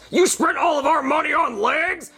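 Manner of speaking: a hint of a boss yelling on the phone; angry boss voice.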